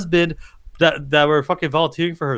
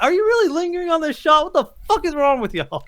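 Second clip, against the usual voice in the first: incredulous outrage; 10 semitones higher than usual. Retold or imitated. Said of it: "I'm like".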